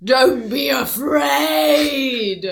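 slow, loud booming goddess; scary goddess voice. Pretend. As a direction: boom it out like a frightening deity. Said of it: "You're a bit frightening".

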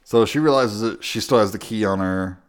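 normal talking voice

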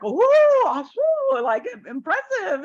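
high, thrilled impressive; impressed cheering. Retold or imitated. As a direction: crowd amazed at the sale. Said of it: "everyone's like".

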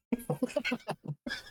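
a short burst of laughter, pitched high. Real, not acted. To the cohost, laughing high about his kids. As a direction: laugh up high at your own story.

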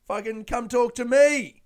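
loud, high mock sales holler; hawker calling out. Nail seller outside Bunnings poaching customers, calling out loudly. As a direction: call customers over, loud and pushy.

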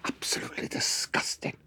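hushed, disgusted whisper; scandalised whisper. Retold or imitated. Aunt pointing out the sick neighbour, whispered disgust. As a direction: whisper it like a shocked gossip. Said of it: "She goes".

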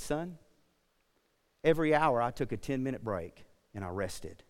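put-on calm old man passing on advice, quiet; quiet fatherly tone to the young man; gentle and kind. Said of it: "his gentle and kind voice".

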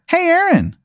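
very high, put-on hailing voice; calling out. Man at Applebee's hails Aaron, in high falsetto. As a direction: hail someone in a squeaky falsetto. Unseen man calls the player.

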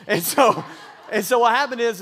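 a real laugh bursting into the words; laughing shout. Real, not acted. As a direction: shout through a laugh at yourself. Laughing at own rambling.

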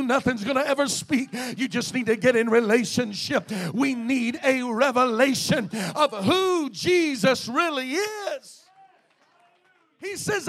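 loud, soaring preacher's cry; full-force preaching. Acted. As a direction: preach it out to the whole church.

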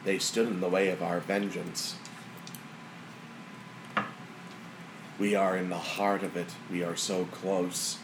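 measured, solemn voice of vengeance; lightly voiced vengeful presence. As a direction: lightly play a brooding tempter bent on revenge.

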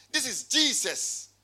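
normal talking voice

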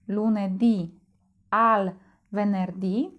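lunedì al venerdì with the final ì stressed; stress on the last vowel. Shown as a demonstration. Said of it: "akcent pojawia się na ostatniej samogłosce".

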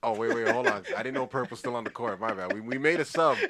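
loud joking ref call; mock ref call. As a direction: call the sub like a referee. Mock referee stops play.

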